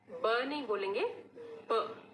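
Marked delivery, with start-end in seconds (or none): 1.7-2.0 short ब with a puff of air; phonics b sound. Shown as a demonstration. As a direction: lips pressed, release ब with pressure. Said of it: "थोड़ा बंद करके ... साथ हवा छोड़ेंगे". (होंठ बंद करके दबाव से ब)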